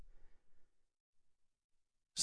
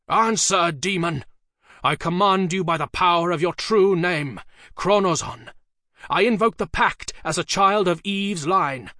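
loud, higher, forceful incantation; commanding shout; 7 semitones higher, 6 dB louder than usual. A narrator's reading. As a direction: demand an answer from the demon. Sorcerer commands the demon.

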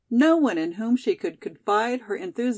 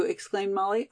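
ordinary delivery, nothing marked

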